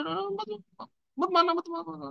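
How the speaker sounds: very high, squeaky pleading; scared little child. Acted. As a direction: mimic a small child begging not to be hit. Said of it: "छोटा बच्चा क्या सोचता है".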